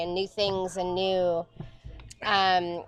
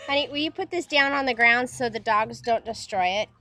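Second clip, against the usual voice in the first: normal talking voice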